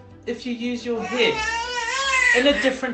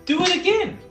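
high, loud demand; high-pitched, keen encouragement; excited urging; 13 semitones higher, 5 dB louder, much faster than usual. Acted. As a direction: cheer them on, high and eager. Kitten delighted, demands repeat.

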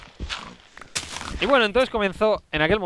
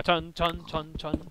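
humming mock epic music; chanting an epic soundtrack; epic music imitation; 6 dB quieter, much slower than usual. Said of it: "introducir música épica".

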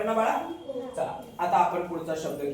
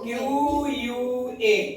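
slow spelling; 5 semitones higher, much slower than usual. Shown as a demonstration. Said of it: "पटकन सांगा".

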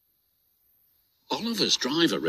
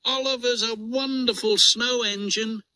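put-on excited children; 10 semitones higher than usual. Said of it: "they cried".

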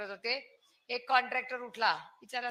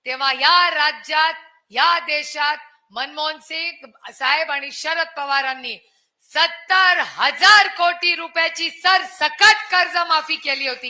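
full-force rally speech; 3 semitones higher, 8 dB louder than usual. Real, not acted. Rally oratory about loan waiver.

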